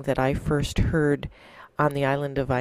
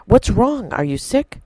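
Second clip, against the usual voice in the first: worried; 8 semitones higher, 8 dB louder than usual. Acted. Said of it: "cried Milo".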